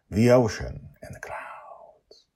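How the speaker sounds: slow hushed whisper of the title. Acted. Host whispers the episode title, slow and hushed. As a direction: breathe the title out slowly.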